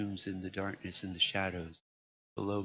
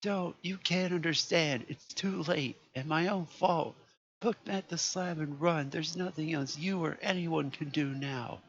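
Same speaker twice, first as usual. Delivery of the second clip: high, loud desperate screaming; begging in utter hopelessness; despairing scream into the phone; 10 semitones higher, 5 dB louder than usual. A narrator's reading. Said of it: "a scream of utter despair".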